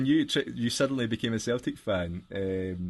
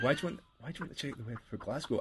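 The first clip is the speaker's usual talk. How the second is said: fast, higher put-on girlfriend voice; mock puzzled girlfriend; 6 semitones higher, 4 dB quieter, faster than usual. Girlfriend questioning him at the bus stop, fast and higher. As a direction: rattle it off like a baffled partner.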